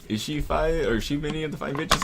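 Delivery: a lightly voiced imitation of a gamer faking Middle Eastern; mock Middle Eastern accent. Retelling a gamer mocking him in a fake Middle Eastern accent. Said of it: "trying to talk like, like Middle Eastern too".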